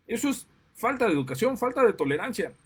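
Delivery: indignant. Real, not acted. Ranting about honking drivers.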